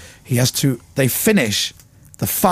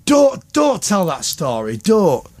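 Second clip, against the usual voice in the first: slow, higher-pitched mock plea; Vernon Kay; 6 semitones higher, much slower than usual. Vernon listening in to the story, mock pleading. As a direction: imitate someone begging you to stop. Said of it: "Vernon will be listening now going".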